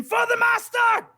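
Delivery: high, drawn-out fanatical shout; frenzied scream. Acted. Vampires going insane for their master, screaming. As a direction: scream it like a crazed minion. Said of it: "just start screaming".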